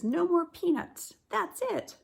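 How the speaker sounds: a high indignant cry; protesting elephant. Acted. Teacher voicing the striking elephant for her class. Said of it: "The elephant says".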